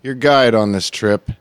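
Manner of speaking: slow, grand show host intro; announcer voice. Acted.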